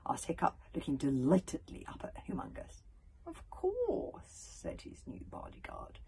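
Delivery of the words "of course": soft, high-pitched assent; obliging reply. A narrator's reading. New bodyguard agreeing to teach Hiccup, softly obliging. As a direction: agree gently and lightly.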